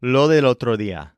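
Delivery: "Lo del otro día" with both Ds softened almost away; loose, barely touched Ds in "Lo del otro día"; lazy Ds. Shown as a demonstration. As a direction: say "Lo del otro día" run together, Ds half-made. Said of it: "lazy letter Ds in it".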